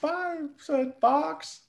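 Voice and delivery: very high, slow squeak; high squeaky voice. Pretend. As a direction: squeak it out slowly. Signing off in odd voice.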